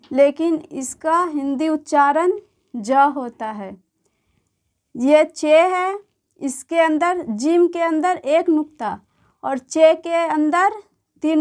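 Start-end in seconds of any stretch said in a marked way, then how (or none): 5.0-6.0 very slow "ये चे है", with चे stretched; letter name demo. Shown as a demonstration. Teacher modelling a letter name for beginners, very slowly. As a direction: draw out "चे" so a child can copy it. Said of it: "चे पढ़ते हैं".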